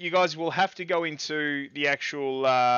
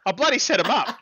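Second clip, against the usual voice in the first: excited; 6 semitones higher, 6 dB louder than usual. Real, not acted. Emote setup he proudly prepared.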